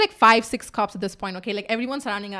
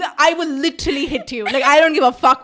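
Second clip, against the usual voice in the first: loud retold warning to a friend; exasperated, punchy mock anger; mock threat; 3 semitones higher, 8 dB louder than usual. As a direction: threaten emphatically, playing it up.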